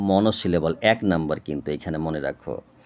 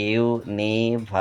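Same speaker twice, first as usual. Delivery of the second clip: University broken into slow, separate syllables; 2 semitones higher, slower than usual. Shown as a demonstration. Teacher showing students the syllables in university, slowly split up. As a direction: sound out university one beat at a time.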